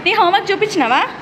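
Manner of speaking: high, loud questions to a little one. Real, not acted. Mother asking son about homework and snacks, child-directed questioning.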